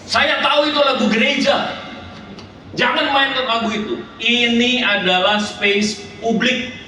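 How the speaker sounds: high-pitched shouted protest; retold shouting. Angry foreigner objects to church music in public, acted shouting. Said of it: "dia teriak".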